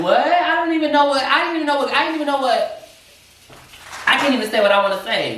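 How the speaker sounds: high, stumbling confused voice; flustered disbelief. Retold or imitated. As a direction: sputter it out in shock. Flustered retold reaction, high.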